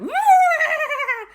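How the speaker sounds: very high, loud ghostly wail; ghostly groan. Acted. Ghosts hearing the news, loud high groan.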